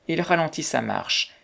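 ordinary delivery

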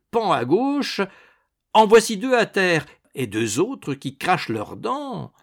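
loud, high, excited cheering; enthusiastic. A narrator's reading. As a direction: cheer on a fighter blow by blow. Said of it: "enthousiasmé, battit des mains frénétiquement". (enthousiaste, exclamations vives)

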